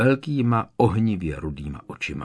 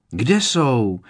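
eager, louder, blurted out; 3 semitones higher, 6 dB louder than usual. A narrator's reading. Students straining to spot the guests, eager blurt. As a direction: blurt it, hungry to see. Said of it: "vyhrkla řada studentů dychtivě".

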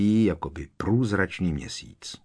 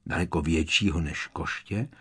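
ordinary delivery, nothing marked

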